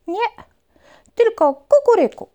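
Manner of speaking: very high, loud rooster. Acted. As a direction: crow it proudly. Rooster answers.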